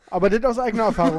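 words squeezed out through laughter; laughing while talking fast. Real, not acted. Bursting with laughter.

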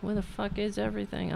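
ordinary delivery

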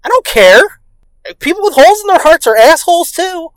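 high, emphatic mock protest; indignant comeback. Child told to be nice, indignant comeback. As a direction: protest it like an exasperated kid.

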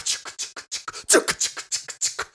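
breathy chugga chugga train sound; whispered train chugging. Pretend. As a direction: puff it like a train engine. Train imitation again.

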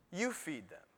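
Jesus quietly telling the disciples to feed the crowd; calm Jesus reply. Retold or imitated. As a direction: say it gently and quietly, no fuss. Jesus answers the disciples calmly.

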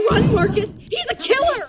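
high, urgent cry of alarm; panicked warning. Acted. Woman warning Marcus he's a killer, high panicked shout. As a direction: warn a friend to flee for their life.

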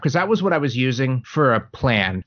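ordinary talk, nothing marked

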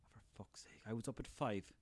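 a low, hushed grumble; muttered mock Dalai Lama. Dalai Lama grumbling at a hungover friend, muttering. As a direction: grumble it under your breath. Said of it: "call you up and be like".

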